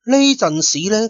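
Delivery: ordinary delivery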